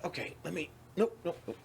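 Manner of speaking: hushed, hesitant self-talk; nervous quiet. Retold or imitated. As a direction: back out nervously under your breath. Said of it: "I was like".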